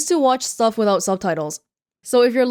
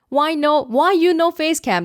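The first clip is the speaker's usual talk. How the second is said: mock broken-English commenter; 6 semitones higher than usual.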